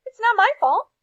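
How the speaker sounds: high, loud defensive yell; flustered principal shouting. A narrator's reading. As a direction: shout it back in self-defence. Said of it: "yelled the principal".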